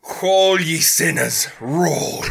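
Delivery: slow, loud preacher's cry; prophetic trance voice. Retold or imitated. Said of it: "Joshua yelled out".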